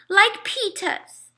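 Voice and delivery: put-on high, slow little-kid voice; a small kid's wish; toddler voice. A narrator's reading. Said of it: "he said".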